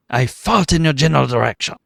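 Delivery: mock the taunt in a hammy French accent; over-the-top comic French accent; Monty Python French accent. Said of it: "sort of French accent".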